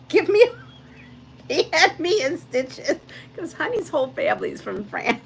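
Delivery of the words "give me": mock French accent. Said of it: "he has a phony French accent".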